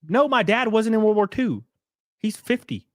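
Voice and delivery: high-pitched mock protest; retold exasperated correction. Setting a literal-minded friend straight, mock exasperation. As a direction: correct them in a high, incredulous voice.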